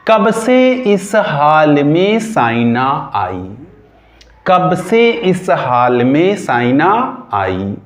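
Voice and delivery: slow, deliberate chant of the memory trick; teacher recites the mnemonic slowly for students to memorise. Shown as a demonstration. Say it slowly, word by word, like a rhyme to remember.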